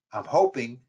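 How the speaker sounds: hoping leaned on; hoping, with the weight on it; stress on hoping. Shown as a demonstration. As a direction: press on the word hoping. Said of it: "emphasis on hoping".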